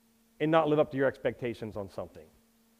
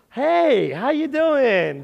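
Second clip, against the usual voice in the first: mock cheery hello; 9 semitones higher, 8 dB louder, much slower than usual. Pastor acts greeting unnamed neighbor.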